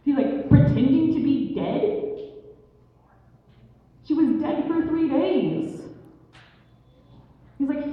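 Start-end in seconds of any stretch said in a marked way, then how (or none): 0.5-5.8 high, loud incredulous retort; indignant disbelief. Acted. Blue Jay answering the man's excuse, indignant and incredulous. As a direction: throw the excuse back in outraged disbelief.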